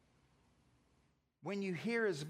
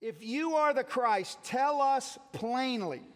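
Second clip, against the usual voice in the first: demanding crowd; 6 semitones higher, 5 dB louder than usual. Retold or imitated.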